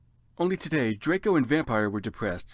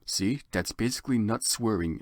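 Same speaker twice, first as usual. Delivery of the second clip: ordinary talk, nothing marked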